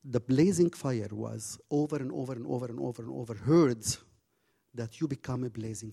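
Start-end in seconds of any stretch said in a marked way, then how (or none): none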